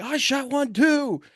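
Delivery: high, slow carrying call; drawn-out distant reply. Retold or imitated. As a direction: holler back across the woods. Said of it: "he's yelling".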